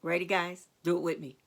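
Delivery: usual speaking voice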